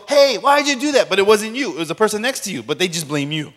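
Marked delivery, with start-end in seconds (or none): 0.1-1.1 high, loud mock teacher scolding. Pastor imitating a teacher scolding a student, loud and high. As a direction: bark it like a teacher blaming the wrong kid. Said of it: "your teacher goes".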